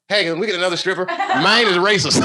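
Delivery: deadpan complaint to bouncer. Retold or imitated. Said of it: "I go".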